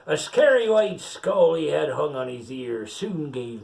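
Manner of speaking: pirate reading a picture book aloud; story reading. Acted. Pirate reads the rhyme to the child, storytelling lilt. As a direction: read the verse out like a bedtime tale.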